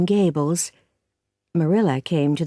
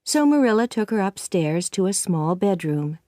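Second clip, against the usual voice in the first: plain reading voice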